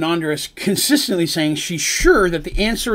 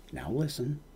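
soft, low, patient "now listen"; fatherly calm; 5 semitones lower, 15 dB quieter than usual. Acted. Father figure calming his angry friend, soft and patient. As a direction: settle her down like a patient father. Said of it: "gets into a very fatherly, patient".

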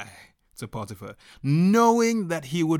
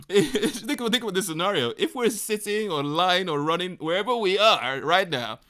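normal talking voice

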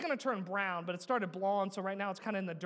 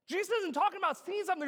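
high, fast, raised pulpit voice; emphatic preaching; 10 semitones higher, 5 dB louder, much faster than usual. Real, not acted. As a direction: rush excitedly up to the scream.

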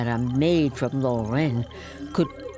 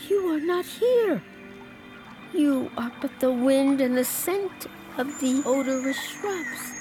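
desperate denial; 10 semitones higher than usual. Acted. Joan denies the vision.

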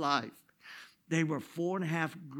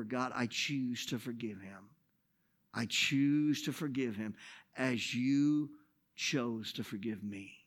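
softer and lower, slow resolve; quiet prayer; 4 semitones lower, 4 dB quieter, slower than usual. Retold or imitated. Pastor praying to forgive the man, soft and low. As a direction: pray it softly, choosing each word.

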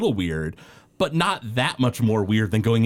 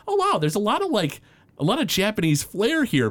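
higher-pitched mock amazement; retold surprise; 8 semitones higher than usual. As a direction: act out a mildly amazed discovery, pitched up. Retells own surprised reaction.

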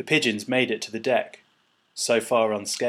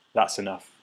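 ordinary delivery

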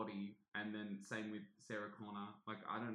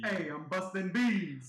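loud, slow, higher comic character yell; goofy tough-guy quote, much louder; hammed-up movie voice; 7 semitones higher, 12 dB louder, slower than usual. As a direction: shout it like a cheesy action-film tough guy. Hammy movie quoting between friends.

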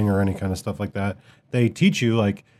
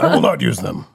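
anime voice. Pretend. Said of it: "not using any contractions".

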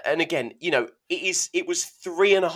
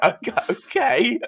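laughing; 10 semitones higher than usual. Retold or imitated. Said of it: "I was like".

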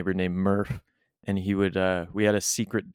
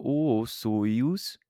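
BC accent; 2 semitones higher than usual. Shown as a demonstration. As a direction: BC Canadian accent, held tight. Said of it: "more tight and kinda in the".

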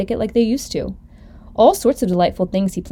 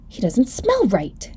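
slow, drawn-out storybook exclamation; mildly startled, stretched-out words; exclaiming; slower than usual. Acted. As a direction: announce a startling discovery slowly. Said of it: "he exclaimed".

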